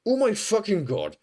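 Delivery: high-pitched exasperated outburst; retold exploding. Lab worker who can't control himself, exploding in exasperation. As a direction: lose control in a re-enacted burst, not shouted. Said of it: "just fully exploding".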